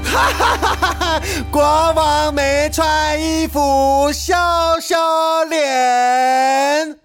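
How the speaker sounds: put-on high little boy's jeering singsong; laughing mockery. A narrator's reading. Little boy pointing at the king, laughing and taunting. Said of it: "指着国王哈哈大笑说".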